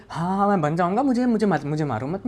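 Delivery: high, loud, panicked begging; desperate pleading. Retold or imitated. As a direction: beg like a child being beaten. Retold boy begging not to be hit.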